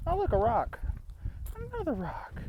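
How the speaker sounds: mock amazement.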